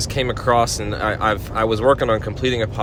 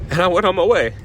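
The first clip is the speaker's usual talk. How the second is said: tearful catch on the line; choking up as you finish; voice breaking with emotion; 8 semitones higher, 3 dB louder, faster than usual. Real, not acted. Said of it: "I don't know why I'm so emotional".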